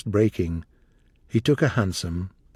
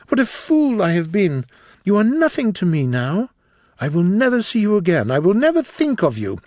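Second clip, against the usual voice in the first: raised, loud scornful speech of a disillusioned lover; Dorian's angry tirade; 7 semitones higher, 4 dB louder than usual. Acted. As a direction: pour contempt on her, voice up high.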